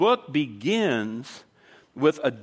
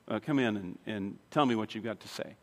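much lower and softer acted quote; retold subdued relenting; 7 semitones lower, 9 dB quieter than usual. Moved man inviting the crying girl inside, retold subdued relenting. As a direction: give in quietly, voice dropped.

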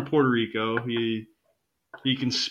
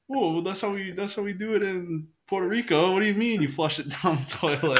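higher, lightly voiced baffled Puerto Rican teammate; imitating Sully's surprise; 8 semitones higher than usual. Sully defending Puerto Rican habits to Ozzy, mock surprise. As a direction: act honestly puzzled by the complaint. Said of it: "And Sully's like".

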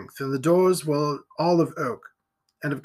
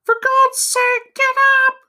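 female voice; 7 dB louder than usual. A narrator's reading. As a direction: cry out as an alarmed woman, very high. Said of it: "a female voice said".

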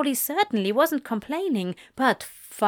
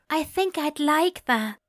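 higher voice with a smile; smiling voice; 6 semitones higher than usual. A narrator's reading. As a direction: let the smile be heard. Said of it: "There was a smile in his voice".

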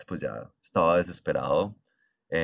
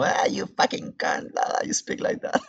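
mocking imitation; 11 semitones higher than usual. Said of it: "se les burlan, es como".

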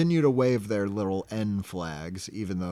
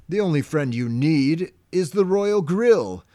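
high, loud mock-regal proclamation; King Neptune; 7 semitones higher, 6 dB louder than usual. Imitating the king refusing SpongeBob's friends, loud mock-regal. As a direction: declare it like a pompous cartoon ruler. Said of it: "And he says".

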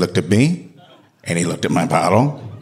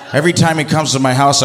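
ordinary talk, nothing marked

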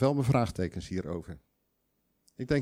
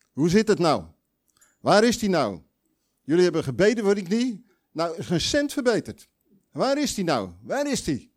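raised, higher, loud reproach at God; rebellious complaint; 7 semitones higher, 6 dB louder than usual. Retold or imitated. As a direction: demand it like a resentful believer. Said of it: "Door te zeggen".

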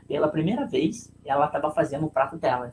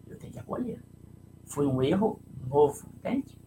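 usual speaking voice

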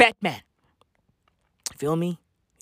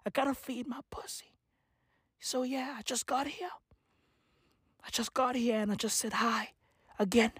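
whispered, much softer cat woman; Catwoman whispering; 13 dB quieter than usual. Acted. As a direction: murmur the excuse. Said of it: "Catwoman is gonna be like".

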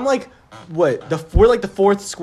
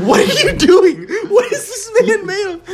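much higher, louder, laughing in disbelief; stunned disbelief; 12 semitones higher, 5 dB louder than usual. Real, not acted. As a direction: cry out amazed at the teammate. Disbelief at teammate's kills.

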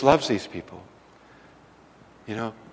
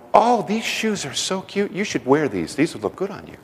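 sweet encouragement; 7 semitones higher than usual. Retold or imitated. Wife cheering up the woman.

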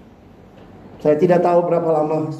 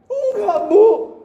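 put-on childlike voice; 10 semitones higher than usual. Crying child answers teacher.

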